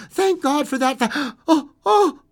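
very high and loud, relief collapsing into a cry; relief breaking into shock. A narrator's reading. Mother realizing her son is dead, crying out loud. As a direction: let the thanks break off into a cry of horror.

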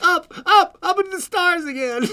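high, loud, rising excitement; climbing narration. A narrator's reading.

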